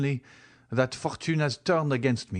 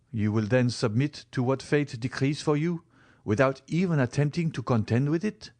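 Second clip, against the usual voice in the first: ordinary delivery, nothing marked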